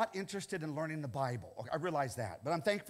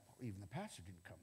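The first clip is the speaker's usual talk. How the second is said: mock complaint; 10 semitones lower, 15 dB quieter, faster than usual. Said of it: "sometimes we got".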